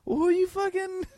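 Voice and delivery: high-pitched incredulous comeback; incredulous retort. Retold or imitated. Man answering girlfriend who's never been turned down, incredulous retort. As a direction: question her claim in squeaky disbelief. Acts disbelief at her claim.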